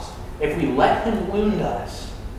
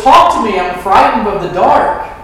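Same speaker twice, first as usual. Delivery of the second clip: put-on raised, louder plea of a frightened boy; retold child's yell; 4 semitones higher, 8 dB louder than usual. Frightened boy calls to auntie in the next room, mock yell. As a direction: imitate a small child yelling for company. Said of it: "he screams at the top of his lungs".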